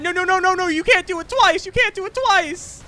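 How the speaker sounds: very high, loud outraged protest; outraged shriek. Real, not acted. Gamer protesting the enemy healing twice, shrieking. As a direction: scream it in disbelief at the unfair move.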